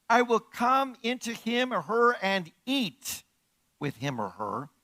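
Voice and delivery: slow, lifted reading of the verse; solemn verse read slowly; slow scripture reading. Acted. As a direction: read it out like holy text. Reads the scripture aloud.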